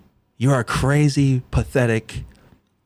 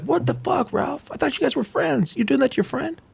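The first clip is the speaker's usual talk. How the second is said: high-pitched imitation of shocked onlookers; mock dismay; 12 semitones higher, 4 dB quieter than usual. Host imitating everybody questioning Ralph's betrayal, mock dismay. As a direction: raise the voice high, softly aghast. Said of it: "and everybody's like".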